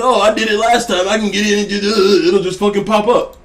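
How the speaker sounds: a touch of a smug gambler talking himself in; mock greedy self. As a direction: mimic his own overconfident self, lightly. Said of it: "[name] thinks".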